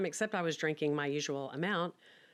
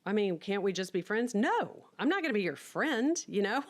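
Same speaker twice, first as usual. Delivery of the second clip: much higher, emphatic sassy no; sassy refusal; 4 semitones higher than usual. Retold or imitated. Turning down her ex's advances, sassy high refusal. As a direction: shut him down with playful defiance. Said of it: "I'm like".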